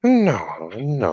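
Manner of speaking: high-pitched cartoon maid impression; Consuela. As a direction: imitate a maid refusing, drawn-out and high. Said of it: "sound like Consuela from Family Guy".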